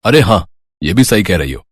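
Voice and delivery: plain reading voice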